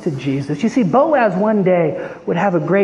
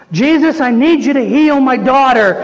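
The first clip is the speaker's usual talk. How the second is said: higher, more urgent repeated plea; insistent plea; 5 semitones higher, 5 dB louder than usual. Acted. Ignored woman begs Jesus to heal her daughter, insistent pleading. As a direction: press harder after being ignored. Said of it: "He ignores her".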